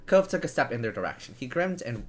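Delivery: ordinary delivery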